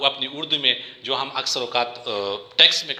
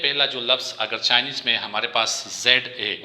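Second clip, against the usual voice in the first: normal talking voice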